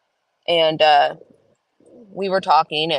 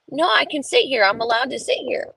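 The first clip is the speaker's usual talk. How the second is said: put-on higher, stubborn child insisting; insistent kid; 7 semitones higher than usual. Son refusing the strange teacher, stubborn kid voice. Son's imagined reply.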